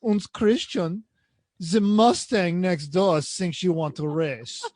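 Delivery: slow, deliberate mock German; bad German accent. Car goading driver at a stoplight, slow German accent. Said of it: "I'm doing a bad German accent".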